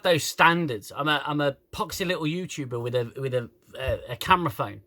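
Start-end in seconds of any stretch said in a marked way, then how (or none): none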